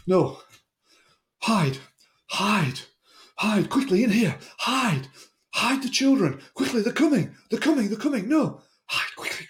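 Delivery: high, frantic, crying urgency; panicked pleading. Retold or imitated. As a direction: beg them to hide, terrified. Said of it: "he started to scream and writhe and yell".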